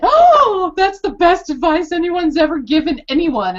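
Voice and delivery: high, loud delighted gasp into praise; thrilled, high-pitched amazement; delighted exclamation. Retold or imitated. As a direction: marvel at the advice. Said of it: "And I just went".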